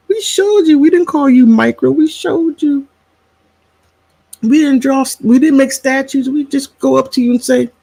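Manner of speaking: high, squeaky mom retort brimming with amusement; imitated mother. Elderly mother sets daughter straight, high-pitched imitation.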